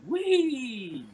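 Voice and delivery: high drawn-out squeal of "wee"; long, loud, high-pitched wee; little voice. Retold or imitated. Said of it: "hear a little voice say".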